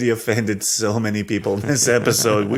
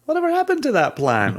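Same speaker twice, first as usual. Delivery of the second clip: quoting listeners, pitched way up; high mock questioning voice; 13 semitones higher, faster than usual. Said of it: "some smart people have reached out to say".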